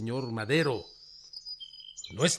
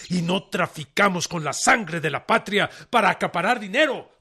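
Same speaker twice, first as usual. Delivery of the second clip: rising anger; 5 semitones higher, 6 dB louder than usual. Acted. Rebel general to the president's envoy, rising indignant outburst. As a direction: voice climbing higher and louder into dinero.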